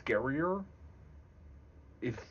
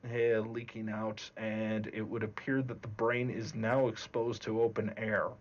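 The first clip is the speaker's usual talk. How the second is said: monotone, matter-of-fact. Shown as a demonstration. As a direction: describe the gore with no emotion at all, unhurried. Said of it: "in a very monotone voice, very matter of fact".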